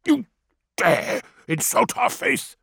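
high, slow, indignant elder; offended elder. A narrator's reading. Narrator voices elder Tefra.

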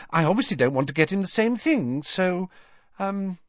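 high, rushed babbling that peters out; chirpy, flustered Hermione. A narrator's reading. Hermione inventing a lame story for Borgin, flustered. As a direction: gabble a made-up excuse in a high voice.

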